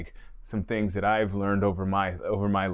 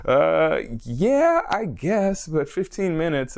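high, louder mock doubt; hammed-up reluctant agreement, pitched way up; sceptical; 8 semitones higher, 5 dB louder, much slower than usual. Acting out own doubtful past thought.

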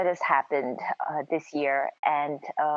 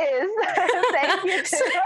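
giggling, higher, a mock award speech; laughing; 12 semitones higher than usual. Real, not acted. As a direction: start an Oscar speech but crack up laughing. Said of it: "like the Oscars".

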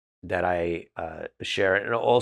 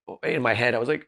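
normal talking voice

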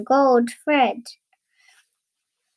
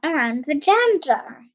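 ordinary delivery, nothing marked

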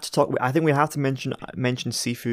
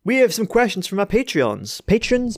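high, bright host read; show-host announcing; 9 semitones higher than usual. Pretend. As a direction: announce it like a presenter.

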